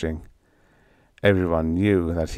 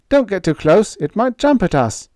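urgent warning; 12 semitones higher, 6 dB louder than usual. A narrator's reading. Warning voice, unnamed character.

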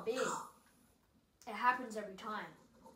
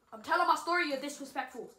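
louder, higher snap; irritated at being interrupted; telling off a friend for interrupting; annoyed; 4 semitones higher, 10 dB louder, faster than usual. Real, not acted.

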